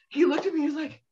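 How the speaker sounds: ordinary talk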